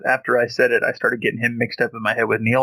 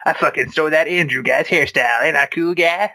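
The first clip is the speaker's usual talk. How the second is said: dumb cool guy voice; 4 semitones higher than usual. Pretend. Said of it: "What if he wrote it as like".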